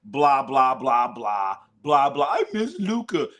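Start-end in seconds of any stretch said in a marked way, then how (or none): 2.3-3.3 high whiny mimic of a weepy kid; mock the boy's sniveling plea; mock crying boy. Mocking the teenage fan crying to the owner, whiny. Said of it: "he starts crying about how he misses Luka".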